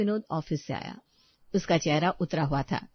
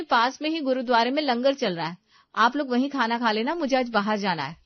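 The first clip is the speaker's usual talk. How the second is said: raised, insistent voice; bossy daughter-in-law; 5 semitones higher, 5 dB louder than usual. Acted. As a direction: send the in-laws off, allowing no argument. Daughter-in-law sends in-laws off. (हुक्म चलाती बहू)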